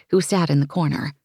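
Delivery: ordinary delivery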